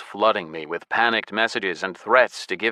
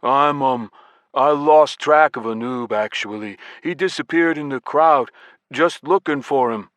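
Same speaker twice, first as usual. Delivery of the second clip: higher-pitched put-on character voice; copying another's speech; 6 semitones higher, 3 dB louder than usual. A narrator's reading. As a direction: bluff along in an imitated voice, pitched up. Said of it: "I copied Banger's manner of speech and intonation".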